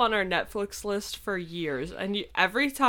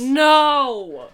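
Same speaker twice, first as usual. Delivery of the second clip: loud; 8 semitones higher, 11 dB louder than usual. Real, not acted. Protesting again.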